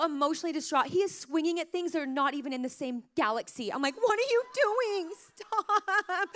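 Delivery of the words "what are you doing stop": exasperated yell. Retold or imitated. Mom yelling at struggling son.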